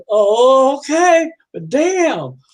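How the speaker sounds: high, loud, drawn-out okay; reluctant acceptance. Acted. Customer hearing about falling coconuts, rattled acceptance. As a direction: concede it while still rattled.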